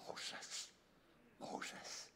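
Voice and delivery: soft, voiceless whisper of a name; hushed whisper, all breath; whispered call. Acted. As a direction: whisper it like a secret summons. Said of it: "Or did he go".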